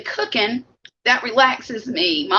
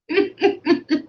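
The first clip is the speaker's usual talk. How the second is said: laugh; 5 semitones higher than usual. Real, not acted. Laughing while eating on stream.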